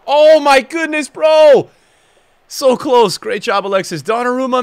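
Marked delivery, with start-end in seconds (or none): 0.1-3.2 loud, drawn-out gasp of disbelief; excited shout. Real, not acted. Gamer to his viewers at a near miss, excited shout.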